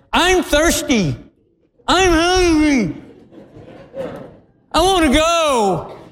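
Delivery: put-on high, drawn-out child's complaint; whiny little girl. Tired little girl complaining to her driver, whiny. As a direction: whine it slowly, high up like a small girl. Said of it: "the little girl said".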